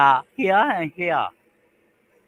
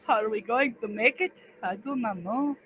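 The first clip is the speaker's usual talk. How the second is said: high, slow squeaky voice; squeaky silly voice; 14 semitones higher, 4 dB louder, slower than usual. Pretend. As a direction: play dumb in a tiny voice.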